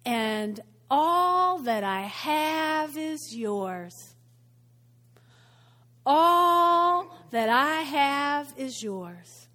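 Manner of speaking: very slow, stretched-out fatherly assurance; loving tone. Acted. Father promises everything to his son, slowly and lovingly. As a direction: let each word linger tenderly. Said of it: "in the same loving tone".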